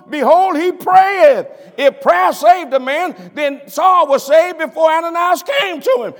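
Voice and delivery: loud, high-pitched preacher's cry; full-force preaching. Acted. Preacher refuting salvation by prayer to the congregation, full-force preaching. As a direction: preach it loud, driving each point home.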